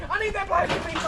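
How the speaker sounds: high insistence. Real, not acted. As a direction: pitch up and insist. Pleading with pig for plastic.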